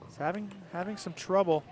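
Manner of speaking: usual speaking voice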